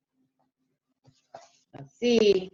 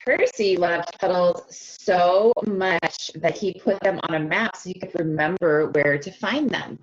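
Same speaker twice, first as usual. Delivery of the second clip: put-on lively storybook reading for young kids; read-aloud narration. A narrator's reading. Teacher reading a picture book to her class, animated read-aloud. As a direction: read it like circle time, bright and inviting.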